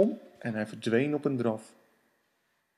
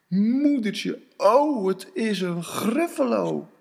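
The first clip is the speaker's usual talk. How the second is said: higher, louder gasp of alarm; startled cry; 5 semitones higher, 3 dB louder, slower than usual. Acted. Mouse startled on seeing the Gruffalo. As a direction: yelp as the monster appears.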